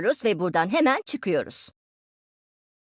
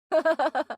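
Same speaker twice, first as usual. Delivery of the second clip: higher-pitched cackle after a taunt; teasing laugh; 4 semitones higher than usual. Acted. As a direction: laugh at your own threat. Rakibine takılıp kendi gülüyor. (muzip, tiz kahkaha)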